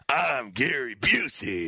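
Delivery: low, drawn-out shouted impression; deep, stretched celebrity voice; Gary Busey. Shown as a demonstration. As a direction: show how it should sound, low and slow. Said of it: "No, it's more like".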